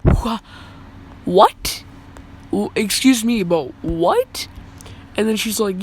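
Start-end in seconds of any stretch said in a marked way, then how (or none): 0.0-4.5 shocked. Retold or imitated. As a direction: sputter in disbelief. Shocked reply to suspension.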